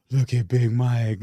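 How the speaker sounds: slow, starstruck murmur after a gasp; acted-out hushed wonder; wide-eyed awe. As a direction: gape at your idol, drawing words out. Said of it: "my eyes were wide".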